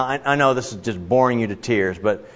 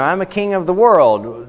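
a lightly raised, boastful king voice; mock proud king; 8 semitones higher, 4 dB louder than usual. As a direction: boast a little, like a pretend king. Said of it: "So I say".

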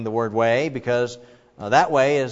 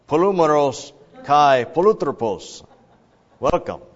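Polumeros kai polutropos in a hammed-up Russian accent, louder, higher and slow; mock Russian accent; 4 semitones higher, 8 dB louder than usual. Pastor joking to the congregation, a louder mock Russian accent. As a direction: boom the Greek out like a playful Russian comrade. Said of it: "It sounds kinda like Russian, you know, comrade".

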